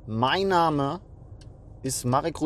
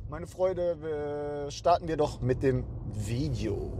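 ordinary talk

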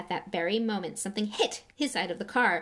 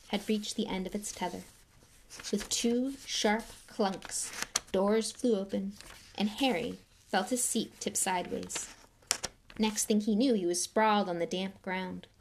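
plain reading voice

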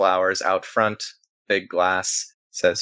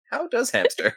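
baffled echo. Acted. Echoing puzzled question in high voice.

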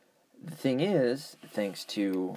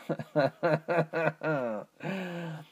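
short amused laugh; 6 semitones higher than usual. Real, not acted. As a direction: laugh at yourself. Laughing at own accent attempt.

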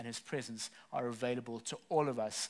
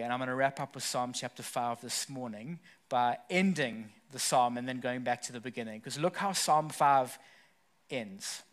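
normal talking voice

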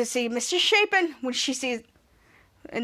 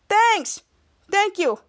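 raised voice to a cheering crowd; mock hero's thanks; 6 semitones higher, slower than usual. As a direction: play a hero acknowledging applause. Hero answers cheering crowd.

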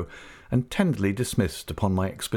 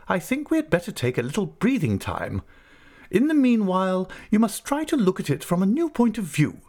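brisk, much higher voice of a stern aunt; 10 semitones higher than usual. Acted. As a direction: play the decisive old aunt. Aunt speaks, voiced high.

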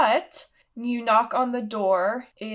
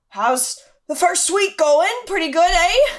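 loud, hearty, higher-pitched host; Hagrid; 9 semitones higher, 8 dB louder than usual. Acted. Hagrid asking about the first week, loud and hearty. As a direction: ask it big and jovial, rising at the end. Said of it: "comes over to you and is like".

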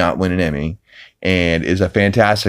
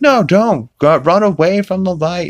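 high, pleading mother voice; high mock alarm; frantic Lois; 11 semitones higher, 3 dB louder than usual. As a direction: beg him to run away, mock-urgent. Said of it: "Lois is like".

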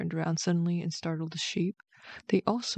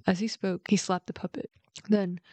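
plain reading voice

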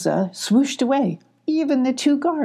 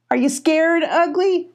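mocking sing-song, much higher; teasing; 9 semitones higher than usual. A narrator's reading. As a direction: tease him like a playground bully. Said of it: "teased Radar".

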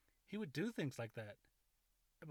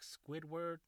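ordinary talk, nothing marked

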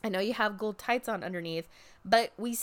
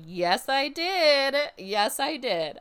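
happy yes; 6 semitones higher, 6 dB louder, slower than usual. Retold or imitated. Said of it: "I was like".

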